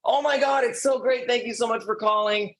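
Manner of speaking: high, gushing, overjoyed client. Retold or imitated. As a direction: play the thrilled caller who can't believe you rang. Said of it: "they're gonna say".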